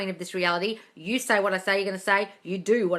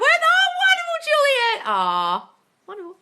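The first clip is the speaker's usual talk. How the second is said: high, loud, sing-song gushing; gushing posh voice; 7 dB louder, slower than usual. Pretend. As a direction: coo like a posh hostess. Gushing over a viewer's comment.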